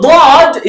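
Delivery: "Dad" drawn out with a long rounded vowel; letter's full name. Shown as a demonstration. Teacher naming the letter, long loud "Dad". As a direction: stretch "Dad" slowly, like "dawd". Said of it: "is the name".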